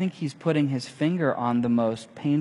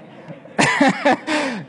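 laugh; 11 semitones higher, 5 dB louder than usual. Real, not acted.

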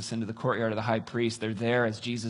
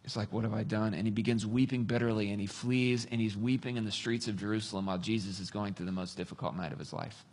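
lower, softer, sombre storytelling; hushed preaching; 4 semitones lower, 7 dB quieter than usual. Real, not acted. Preacher tells of Peter weeping, hushed and sombre. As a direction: let it sink toward a near-whisper.

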